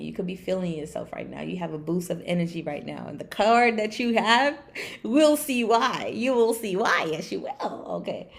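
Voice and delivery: ordinary delivery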